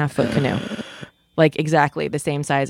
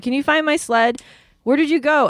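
raised-pitch retold holler; retold yelling; 8 semitones higher than usual. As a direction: imitate calling out to someone out of sight. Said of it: "I'm yelling".

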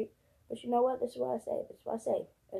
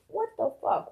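outraged disbelief; 9 semitones higher than usual. Real, not acted. Ranting about taxes.

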